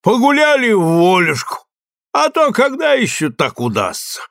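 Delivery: merry soldier voice. Acted. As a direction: boast of the spree like a jolly private. Soldier boasts of spree.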